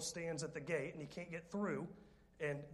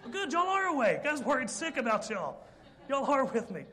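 much higher, louder, laughing tease; teasing the congregation; 8 semitones higher, 10 dB louder than usual. Real, not acted. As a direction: wake them up with a loud playful jab. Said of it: "men are giggling uncomfortably".

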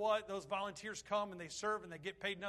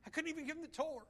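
choked up; 5 semitones higher than usual. Real, not acted. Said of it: "and I wept".

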